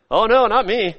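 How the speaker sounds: a hint of a flustered Peter; mock innocent denial. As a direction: brush it off like a caught liar. Peter denies being a follower.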